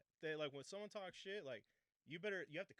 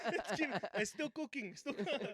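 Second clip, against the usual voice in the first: mock Mexican accent; 7 semitones higher, 9 dB louder than usual. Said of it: "I did a Mexican".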